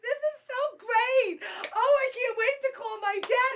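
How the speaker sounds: high, slow, delighted cry of joy; thrilled squeal. Acted. Thrilled guest squealing to the host about her big break. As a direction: gasp it out, overjoyed.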